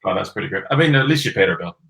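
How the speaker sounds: ordinary talk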